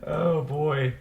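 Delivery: pushing words out without breathing; holding breath. Real, not acted. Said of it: "talk while holding your breath".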